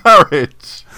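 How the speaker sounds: sudden loud outburst; loud exclamation. Acted. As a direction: belt out the one word. Blurts improv line, much louder.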